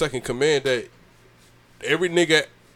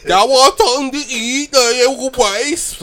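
high, louder mock voice, slowing on the answer; imitating Behemoth; 9 semitones higher, 6 dB louder than usual. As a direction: keep mocking her high drawn-out speech. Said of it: "That's how she talked".

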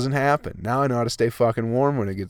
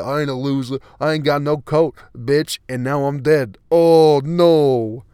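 louder, slow hammed-up tough guy; mock tough guy; 3 semitones higher, 5 dB louder, slower than usual. Vlogger acting a coatless fool who dies, hammed-up defiance. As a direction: play the coatless guy who freezes to death, overdone.

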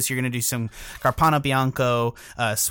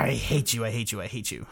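repeated, growing softer each time until it dies away; fading mock grumble; 3 semitones lower, 7 dB quieter than usual. Real, not acted. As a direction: let the grumble die out. Repeats curse at computer, fading.

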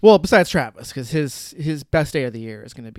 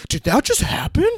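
high, loud cheesy movie reaction; hammed-up incredulous question; mock disbelief; 9 semitones higher, 6 dB louder than usual. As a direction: imitate a character commenting on the obvious. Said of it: "commenting on things that are happening".

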